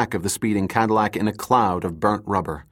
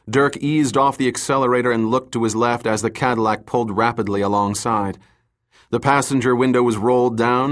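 ordinary delivery, nothing marked

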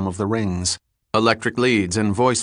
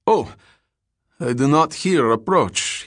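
put-on higher-pitched Russian-accented officer; startled officer with a thick Russian accent; heavy Russian accent; 6 semitones higher than usual. A narrator's reading. Startled lieutenant answering the detective, heavy Russian accent. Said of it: "in a heavy Russian accent".

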